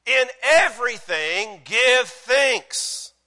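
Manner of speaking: loud, high, drawn-out preaching; emphatic proclamation. Real, not acted. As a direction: declare it slowly, word by word. Preacher proclaims verse as upgrade.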